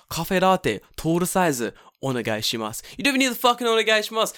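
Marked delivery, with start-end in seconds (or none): 0.1-2.8 Japanese-accented order. Shown as a demonstration. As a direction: order coffee the Japanese way, "kafe ratte". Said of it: "In Japanese, you go".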